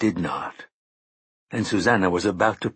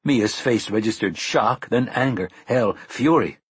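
ordinary delivery, nothing marked

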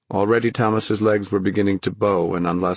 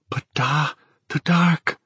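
put-on frightened boy; 8 semitones higher, slower than usual. A narrator's reading. Frightened boy pleading with father.

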